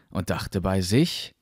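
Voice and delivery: ordinary delivery, nothing marked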